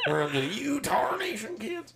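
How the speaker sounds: put-on hollering like a grumpy old geezer at kids; old prospector. Joking about old men yelling at kids.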